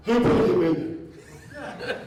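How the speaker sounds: loud, drawn-out booming demand. Retold or imitated. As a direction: boom it out slowly, like a voice from above. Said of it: "I hear this voice boom and go".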